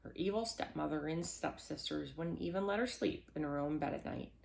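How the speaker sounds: ordinary delivery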